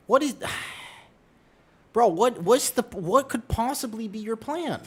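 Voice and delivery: loud, high-pitched disbelief; high, loud pleading protest; exasperated scolding. Real, not acted. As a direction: scold a pet in incredulous exasperation. Scolding cat climbing to the window.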